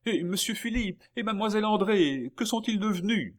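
high, loud anxious cry; calling out. A narrator's reading. Maid on the doorstep to the returning baron, crying out. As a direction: cry the question out across the street.

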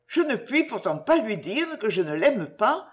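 usual speaking voice

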